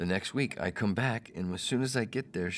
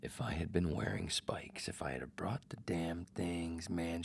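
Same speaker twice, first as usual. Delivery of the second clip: whisper; 4 dB quieter, slower than usual. Real, not acted. Bitter aside about her fault.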